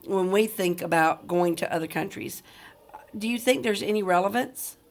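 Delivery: ordinary talk, nothing marked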